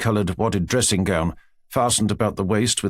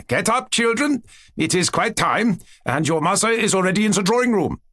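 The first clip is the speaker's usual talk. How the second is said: cheerful German tutor calling out, much higher; strong German accent; 9 semitones higher than usual. Acted. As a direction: exclaim it in a strong German accent, waking the children. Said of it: "he exclaimed in his strong German accent".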